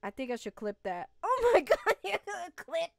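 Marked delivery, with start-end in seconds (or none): 1.2-2.9 loud laughing words. Real, not acted. Laughing about clipping.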